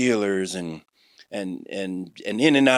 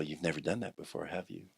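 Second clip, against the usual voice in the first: soft, much quieter confiding question; a soft knowing question, close in; leaning in quietly; 4 semitones lower, 15 dB quieter than usual. Retold or imitated. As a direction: lean in and say it low and gentle. Pastor leans in, intimate quote.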